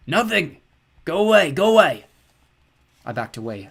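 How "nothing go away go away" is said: high, loud cracked yell; cracked creature shout. Acted. Skellig shouting the boy away, loud cracked. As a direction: drive the boy off like a cornered creature.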